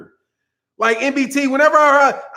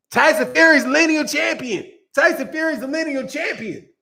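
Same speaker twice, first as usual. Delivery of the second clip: mock obedient recital; 6 semitones higher than usual. Mock coaching a fighter to repeat.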